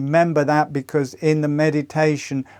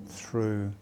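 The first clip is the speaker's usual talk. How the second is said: gentle fading meditation guide; guided meditation. Acted. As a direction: guide them into calm with long pauses. Leads a meditation.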